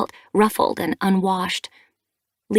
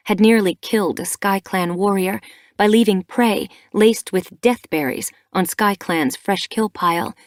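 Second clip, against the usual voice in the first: ordinary delivery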